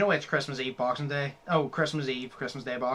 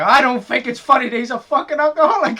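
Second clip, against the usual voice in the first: higher, louder offended quote; mock indignant; 9 semitones higher, 9 dB louder than usual. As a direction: sound deeply offended. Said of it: "It's like".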